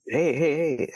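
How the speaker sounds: high, quick warning call, retold. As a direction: mimic hailing a driver who is backing up. Retells calling out to backing driver.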